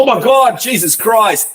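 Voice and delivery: loud, high-pitched burst of exclamation; mock awestruck outcry. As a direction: blurt it out like a gobsmacked dad. Voicing the distracted dad's reaction.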